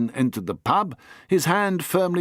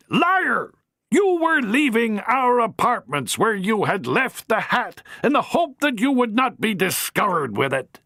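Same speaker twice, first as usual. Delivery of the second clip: high, cutting accusation; furious matron; 11 semitones higher than usual. Acted. Matron interrupts Harry, furious. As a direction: cut him off hard.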